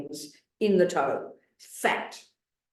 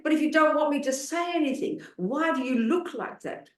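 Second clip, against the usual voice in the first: put-on upset old man; 7 semitones higher than usual. Nun retells tearful old man answering her, a higher mock-hurt plea. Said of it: "and he was so upset".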